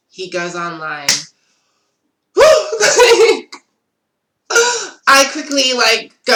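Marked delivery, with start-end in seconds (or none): 2.3-3.6 a sudden high, loud shriek of a laugh; loud burst of laughter. Real, not acted. Caught out when he comes online, a loud shrieking laugh.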